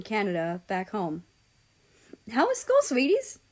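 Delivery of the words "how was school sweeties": much higher, sweet motherly voice. Acted. Mother greeting her kids after school, sweet and high. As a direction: ask it like a loving mom. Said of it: "she asked".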